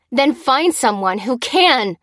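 forceful, drawn-out angry command; bellow. A narrator's reading. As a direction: bellow at a useless bystander. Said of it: "bellowed Lucy".

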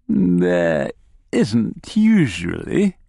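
slow, drawling gnome voice; gnome character. Acted. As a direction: stretch the answer out in character.

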